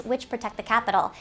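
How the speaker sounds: usual speaking voice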